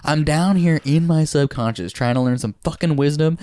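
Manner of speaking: hammed-up exasperated tirade; super pissed. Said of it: "he starts getting super pissed".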